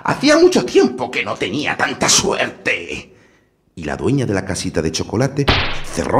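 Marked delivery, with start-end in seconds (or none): 0.0-3.2 wicked witch. A narrator's reading. Witch gloats.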